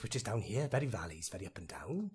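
low Welsh valleys lilt; Welsh accent. Shown as a demonstration. Actor touring UK accents, soft low Welsh lilt. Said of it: "we've got the Welsh".

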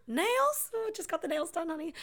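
high, softened fussy voice; dainty put-on protest; mock fussing. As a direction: pitch it up, imitating a precious fuss over nails. Voicing Madeline Kahn's fussing refusal.

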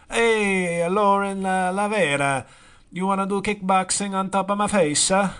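mock Italian guy taunting. Vlogger as a fake Italian guy taunting the actress. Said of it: "My name is Gianluigi Covini".

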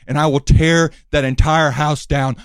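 loud, menacing heel promo; wrestling promo. Acted. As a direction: cut a threatening promo.

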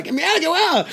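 very high, loud mock outrage; hammed-up shock at rival actors; mock outraged squeal. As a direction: squeak it out in disbelief. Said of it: "Y ahora".

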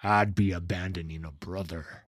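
understated edge of suspicion; subtle sharpness. Acted. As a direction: keep it civil but pointed. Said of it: "voice carried a subtle sharpness".

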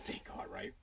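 a sly relieved whisper under the breath; relieved whisper, just for the listener; whispered aside. Real, not acted. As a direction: breathe "thank God, right?" with no voice at all. Whispered aside about mother-in-law.

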